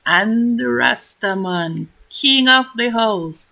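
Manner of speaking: grand announcer narration; announcer voiceover. A narrator's reading. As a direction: announce the feast like a host.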